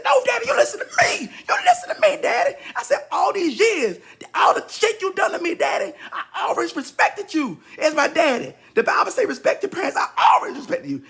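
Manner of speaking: high, loud tearful shouting; crying, shouting. Retold or imitated. Son yells at dad through tears, crying shout. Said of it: "Of course, I was crying".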